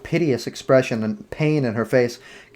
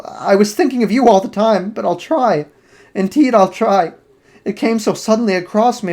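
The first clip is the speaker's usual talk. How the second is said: put-on higher, tearful young woman begging him to stay; desperate actress begging her lover not to leave, higher voice; Sybil's desperate pleading; 7 semitones higher, 5 dB louder than usual. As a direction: plead breathlessly, voice lifted and urgent.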